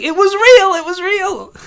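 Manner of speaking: high, loud giddy squeal; gleeful high squeal. Acted. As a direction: squeal it out like a delighted kid. Gleeful waking boy voice.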